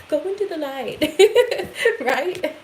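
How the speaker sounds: laughing through the words, high. Real, not acted. Reader amused by her own line, laughing. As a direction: giggle at your own phrase.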